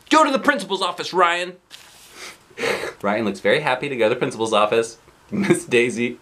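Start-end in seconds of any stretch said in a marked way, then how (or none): 0.1-1.6 quick high stern order; stern teacher order. Acted. Teacher orders Ryan to the office, quick stern. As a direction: send him out fast. Teacher sends boy to principal.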